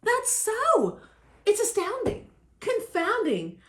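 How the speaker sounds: high, swooping astonishment; amazed. A narrator's reading. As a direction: marvel at it, pitch leaping up. Elephant amazed its sneeze is gone.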